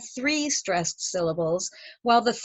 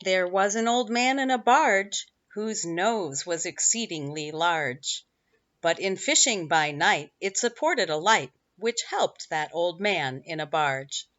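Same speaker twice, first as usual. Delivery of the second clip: poem recitation; 4 semitones lower than usual. Acted. Reads a limerick aloud.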